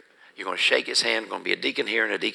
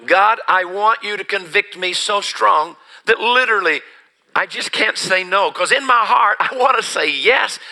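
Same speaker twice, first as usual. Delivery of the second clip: much higher and louder, pleading with God; desperate prayer; 8 semitones higher, 6 dB louder than usual. Retold or imitated. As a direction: beg God to break your will. Retold desperate prayer for conviction.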